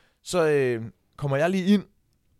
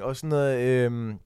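ordinary talk

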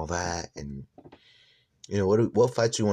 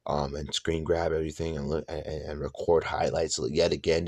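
ordinary talk

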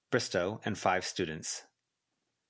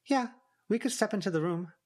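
put-on much higher, quick girl voice; Verona voice; 12 semitones higher than usual. A narrator's reading. Verona warning Avery about augurs, high and quick. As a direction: pitch way up and hurry for Verona.

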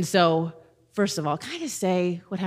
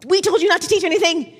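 much higher, louder, sing-song dismissal; high mocking council voice; 10 semitones higher, 5 dB louder than usual. Pastor mocking council brushing off the apostles, high. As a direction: lampoon the council ignoring the miracle.

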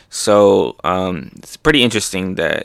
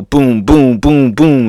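punchy, louder "boom" hit noises; pounding booms; 3 semitones higher, 5 dB louder, slower than usual. Acted. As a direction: imitate rapid cartoon punches. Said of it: "hitting every angle".

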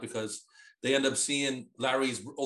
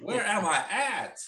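high, slow bewildered question; mock dazed man, slow and high; dazed Petey; 7 semitones higher, much slower than usual. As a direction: drag it out, totally lost. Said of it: "He's like".

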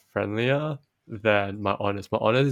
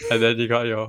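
ordinary talk, nothing marked

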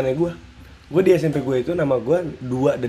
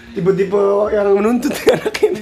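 higher pitch, laughing through the words; teasing laughter; 6 semitones higher, 4 dB louder than usual. Real, not acted. Friend teases him as a demanding kid, laughing.

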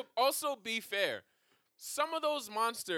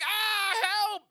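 much higher, louder hammed-up scream for help; mock cry for help; 13 semitones higher, 6 dB louder than usual. Rangers baked in the pizza call out, mock panic. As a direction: wail like trapped cartoon heroes. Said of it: "they're just like".

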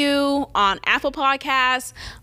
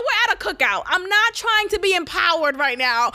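ordinary talk, nothing marked

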